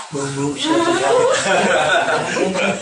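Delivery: slow, sing-song nursery book; mocking nursery-book reading. Mocking the books given to kids, slow sing-song. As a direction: read it like baby talk, mocking. Mocking childish storybook text.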